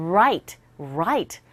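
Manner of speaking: "right" begun from an "oo" shape; slow "right", lips rounded into the R. Shown as a demonstration. Teacher models R against L, "right" led in by a rounded "oo". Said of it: "R 사운드는 우한 상태에서".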